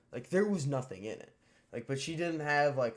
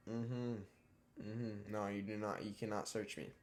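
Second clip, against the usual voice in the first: softer, low-key "no, you cannot"; muttered refusal; 3 semitones lower, 8 dB quieter than usual. Retold or imitated. Teen refusing a school search, softer and dismissive. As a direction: calmly shut down the search, softly. Telling off a search, under his breath.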